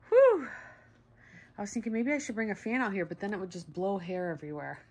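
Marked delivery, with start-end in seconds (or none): 0.1-0.5 loud exhausted whew. Real, not acted. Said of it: "I am out of breath".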